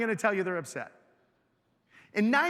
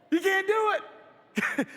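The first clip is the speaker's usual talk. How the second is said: teasing laugh; 8 semitones higher, much slower than usual. Real, not acted. Teases the audience.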